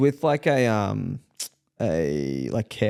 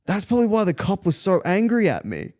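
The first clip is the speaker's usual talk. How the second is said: higher voice, working it out; older German gentleman; 8 semitones higher than usual. Retold or imitated. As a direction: lightly imitate an older German man's realisation. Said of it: "This bloke was a German older gentleman".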